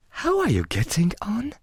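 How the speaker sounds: high, soft cat voice; talking cat. A narrator's reading. Cheshire Cat greeting Alice, soft high creature voice. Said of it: "said the cat".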